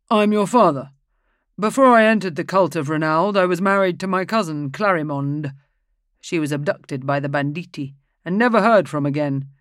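a priest setting the record straight; indignant priest. Acted. Ambrosio's revelation.

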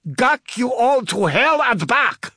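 furious high-pitched yell; screamed. A narrator's reading. Soldier firing at boarders, screaming. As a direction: scream it out loud at the enemy. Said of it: "she screamed".

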